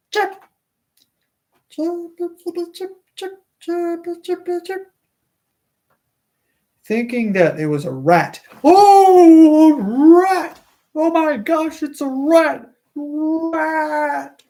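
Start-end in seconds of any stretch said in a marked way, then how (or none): 0.1-4.9 tiny high squeaky chirps; high chirping. Acted. Reader imitating the squeaking chirp heard in the dark. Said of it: "a squeaking, chirping sound". 8.6-14.4 high, loud startled cry; startled shriek. Acted. Midwife frightened by a rat in the dark, high shriek.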